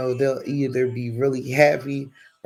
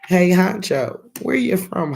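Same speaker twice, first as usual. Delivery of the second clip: high, loud call out to a viewer; excited greeting; 6 semitones higher, 6 dB louder than usual. Real, not acted. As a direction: hail him brightly from the stream.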